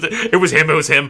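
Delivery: put-on girl telling her father who saved her; eager girl. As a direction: point him out excitedly. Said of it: "And Mina, you're just".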